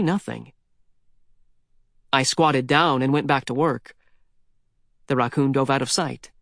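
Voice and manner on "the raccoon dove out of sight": ordinary delivery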